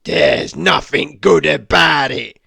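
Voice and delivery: loud, sour snap back; grouchy neighbour barks a loud reply; grumpy retort. Acted. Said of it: "retorted Mister Grumpy".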